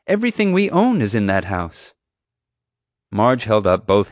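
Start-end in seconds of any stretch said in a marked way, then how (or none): 0.1-1.9 high, distraught insistence; at wit's end. A narrator's reading. Mom telling the guard what is lost, at wit's end. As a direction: plead the point in frustration. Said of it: "nearing her wit's end".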